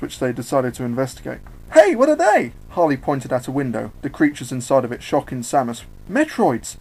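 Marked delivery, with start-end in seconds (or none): 1.7-2.5 put-on very high, loud girlish question; high-pitched, excited call; curious squeal. Harley spots creatures, calls out. 6.1-6.8 shocked, pitched-up exclamation; startled cry, high then falling; gasped. Acted. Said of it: "she gasped".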